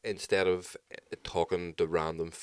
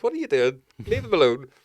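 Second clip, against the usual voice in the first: raised scolding voice, higher; telling off; 7 semitones higher, 6 dB louder than usual. Retold or imitated. Parent scolding himself about his kids, raised voice. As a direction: snap at yourself to leave the kids alone.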